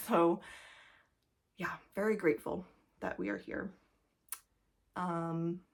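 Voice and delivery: breathless, whispery and winded; out of breath from talking. Real, not acted. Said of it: "sorry, just getting out of breath".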